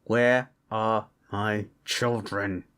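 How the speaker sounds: slow, low, word-by-word demand; menacing demand. Acted. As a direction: space out each word as a threat. Father confronts Bones over missing kids.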